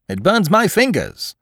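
usual speaking voice

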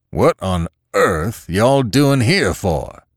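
put-on slow, puzzled old man's voice; bewildered old farmer. A narrator's reading. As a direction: drag out each word, baffled. Father confused by family in barn.

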